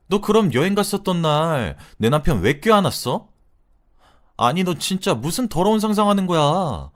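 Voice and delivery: angry confrontation. Retold or imitated. Confronting friend who hugged husband.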